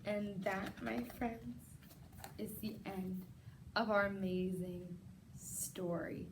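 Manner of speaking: soft story ending. A narrator's reading. Closing the story softly.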